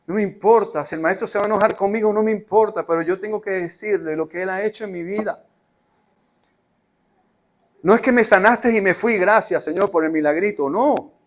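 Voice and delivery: loud, high, determined testimony; the woman defiantly testifying, loud; bold declaration. Acted. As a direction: declare it with no fear of anger.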